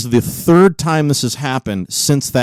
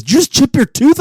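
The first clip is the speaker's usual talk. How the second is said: imitating wife; 11 semitones higher than usual. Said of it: "She goes".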